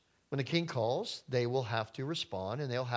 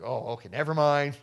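backing down; 3 semitones lower, 5 dB louder than usual. Pretend. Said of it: "They're like".

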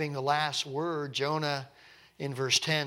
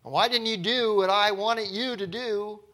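higher, louder accusing question; angry at God; 6 semitones higher, 5 dB louder than usual. Acted. Believer secretly blaming God, acted-out anger. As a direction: demand an answer, acting out anger. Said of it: "I'm really angry with Him".

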